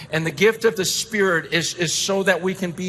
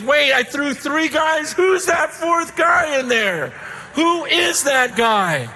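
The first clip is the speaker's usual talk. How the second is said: astonished king; 9 semitones higher, slower than usual. Retold or imitated. King sees a fourth man in the fire, astonished shout. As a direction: shout it in amazement. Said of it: "and the king goes".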